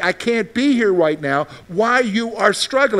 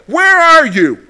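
shouted much higher and louder, slowly; frustrated cry to God; 10 semitones higher, 9 dB louder, slower than usual. Retold or imitated. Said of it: "I'm like".

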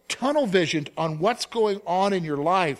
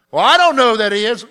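doubting listener; 5 semitones higher, 12 dB louder, faster than usual. Retold or imitated. Listener's doubting reply voiced.

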